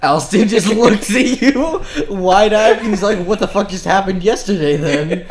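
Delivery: real laughter; laughing. Real, not acted. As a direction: laugh while you tell it.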